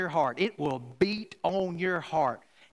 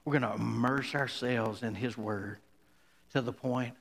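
lower, softer prayerful close; quiet closing prayer; 7 semitones lower, 4 dB quieter, faster than usual. Acted. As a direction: wind down gently into a prayer for the church.

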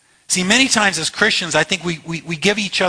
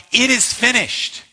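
slow, higher cry of Jesus from the cross; 7 semitones higher than usual. Acted. Preacher voicing Jesus on the cross, a slow high cry. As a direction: let it ring out high and slow, a last cry.

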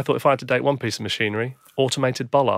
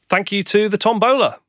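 raffle caller; 9 semitones higher, 6 dB louder than usual. Pretend. Host thanking the tombola.

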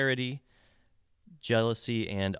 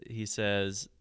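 ordinary talk

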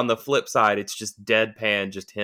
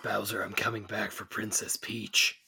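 whispered, gravelly movie-hero threat; whispered action hero; 10 dB quieter than usual. Acted. Mario as a dark action hero, whispered vow.

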